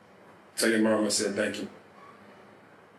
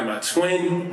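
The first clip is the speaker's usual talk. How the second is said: ordinary talk, nothing marked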